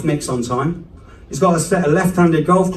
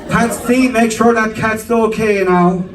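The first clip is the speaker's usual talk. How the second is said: high, calling out to dad; mum calling; 7 semitones higher, slower than usual. Retold or imitated. Mum calls dad about the cat, high and doting. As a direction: call him from the next room. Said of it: "that's Patsy's my dad".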